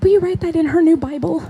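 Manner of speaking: high-pitched mock mom voice; imitated mom. Mom asking daughter to write in Bible, imitated mom. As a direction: ask it high, imitating an eager mother. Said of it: "my mom's like".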